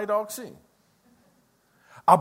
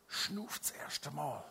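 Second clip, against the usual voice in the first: ordinary talk, nothing marked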